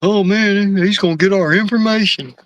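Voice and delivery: high, whiny mock of scared people; mocking the worriers. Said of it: "People really think that".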